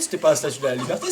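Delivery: ordinary delivery, nothing marked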